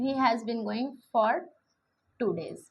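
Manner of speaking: stretched, slowly spaced words; slow dictation. Shown as a demonstration. Teacher writing the example on the board, dictating slowly. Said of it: "लिख देती हूँ".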